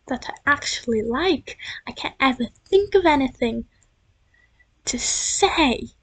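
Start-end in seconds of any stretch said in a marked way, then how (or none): none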